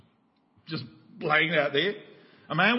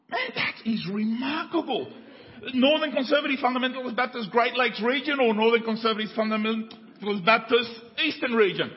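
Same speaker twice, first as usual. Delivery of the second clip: amazed stranger; 9 semitones higher, 4 dB louder than usual. Retold or imitated. Stranger on the bridge marvelling at the match, higher and louder. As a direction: marvel at the coincidence.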